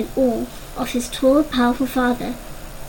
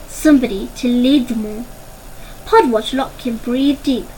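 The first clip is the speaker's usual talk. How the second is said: plain reading voice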